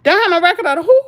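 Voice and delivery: disbelieving echo of the friend's line; incredulous echo. Real, not acted. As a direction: repeat it back like you can't believe it.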